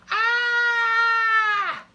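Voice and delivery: long held high shouted ah; imitated scream. Big Max imitating the sound to the king, loud scream. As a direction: mimic the loud cry. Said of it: "They do not say".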